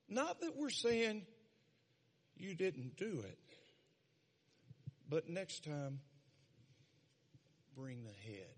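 ordinary talk, nothing marked

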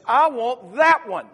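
loud, high, slow insistence; insistent boy. Acted. Little boy to store owner, loudly insisting on the puppy. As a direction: plant your feet and demand it.

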